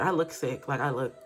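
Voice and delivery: ordinary talk, nothing marked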